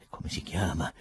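usual speaking voice

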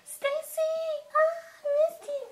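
very high, squealing hello; overjoyed to see her friend; excited greeting. Acted. Stacy greets her best friend.

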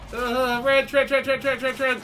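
frantic yelling. Real, not acted. Frantic repeated name calling.

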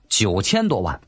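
plain reading voice

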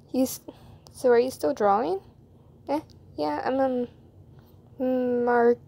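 plain reading voice

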